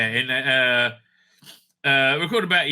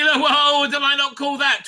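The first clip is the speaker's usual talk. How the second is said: much higher and louder, thrilled at being proven right; excited whoa; 11 semitones higher, 5 dB louder than usual. Real, not acted. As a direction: burst out, delighted to have called it. Discovers proof his guess was right.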